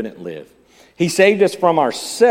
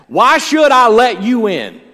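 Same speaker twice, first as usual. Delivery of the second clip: booming judge; 9 semitones higher, 9 dB louder, slower than usual. Acted. Judgment day question quoted.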